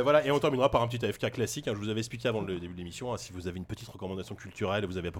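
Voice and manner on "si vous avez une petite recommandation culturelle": usual speaking voice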